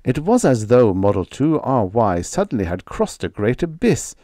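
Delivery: ordinary delivery, nothing marked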